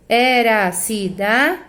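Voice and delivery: "era a cidade" slowly, with "era a" fused as era; pronounced joined. Shown as a demonstration. Teacher showing how vowels join, "era a cidade" as e-ra-ci-da, de. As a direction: merge the vowels in "era a cidade", pausing before de. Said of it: "eu pronuncio junto".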